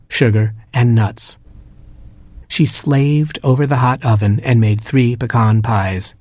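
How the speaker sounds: ordinary delivery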